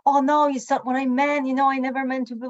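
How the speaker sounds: defensive protest. Retold or imitated. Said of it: "instead of defending my position".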